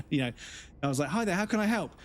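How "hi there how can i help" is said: bright, lifted customer-service greeting; cheery call agent. Retold or imitated. Call agent greets an incoming caller, chirpy and lifted. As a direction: chirp the phone greeting like a keen call handler.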